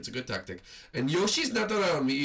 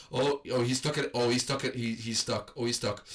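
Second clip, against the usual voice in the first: ordinary talk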